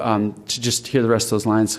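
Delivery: ordinary delivery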